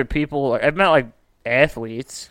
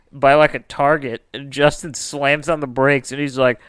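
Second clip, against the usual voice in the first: normal talking voice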